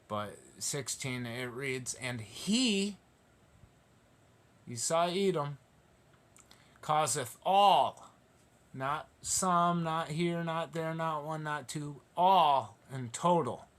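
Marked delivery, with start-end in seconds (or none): 1.9-13.7 higher, slow, hammering all, not some; fiery preaching. Acted. Preacher to congregation, hammering fiery emphasis. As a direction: drive each not home.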